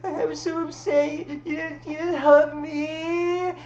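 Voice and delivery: high, slow, whiny pout about not getting a hug; whiny Vanessa. Retold or imitated. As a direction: drag out the complaint like a hurt child. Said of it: "she walks in the room like".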